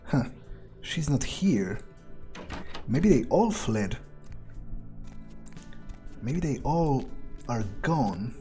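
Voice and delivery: ordinary delivery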